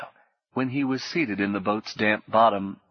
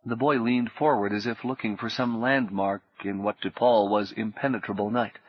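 ordinary delivery

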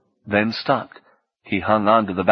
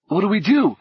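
high, alarmed question; panicky question; 10 semitones higher than usual. A narrator's reading. Fredericks panicking as the raft sinks, alarmed. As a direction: voice jumping up in alarm.

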